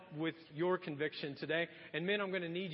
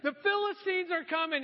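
high, raised-voice alarm; mock yelled warning; 11 semitones higher, 5 dB louder than usual. Delilah waking Samson to test him, yelling out. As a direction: call out the warning as Delilah in a retelling. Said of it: "she yells out".

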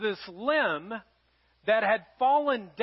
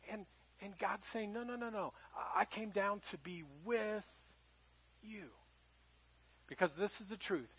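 intimate preaching; 2 semitones lower, 10 dB quieter than usual. Acted. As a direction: draw the room close and speak quietly. Quiet closing appeal of a sermon.

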